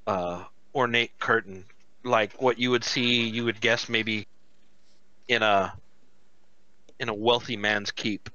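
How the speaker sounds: ordinary talk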